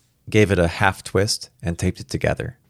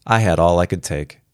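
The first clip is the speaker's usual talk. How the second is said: ordinary delivery, nothing marked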